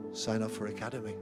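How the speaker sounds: ordinary talk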